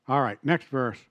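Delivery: ordinary talk, nothing marked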